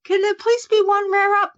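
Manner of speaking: high, begging mock plea; high pleading wish for a rare spawn. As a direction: beg the game for a break. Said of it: "I was like".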